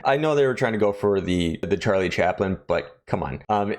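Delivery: normal talking voice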